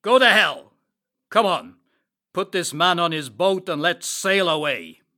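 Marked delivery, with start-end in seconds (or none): 0.0-0.7 angry. Acted. Captain dismisses Prendick.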